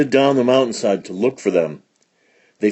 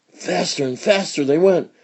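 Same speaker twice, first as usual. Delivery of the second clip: ordinary delivery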